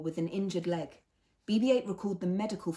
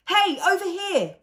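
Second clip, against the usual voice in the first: high, loud, drawn-out call; crying out; 13 semitones higher, 10 dB louder, slower than usual. A narrator's reading. Fez calling the steel peckers to him, loud. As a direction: shout to lure the birds away. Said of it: "cried Fez".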